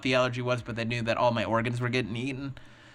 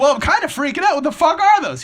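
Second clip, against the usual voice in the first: high, loud panicked yell; mock panic, shouting up high; patient panicking loudly at the ultrasound tech; freaking out; 13 semitones higher, 10 dB louder than usual.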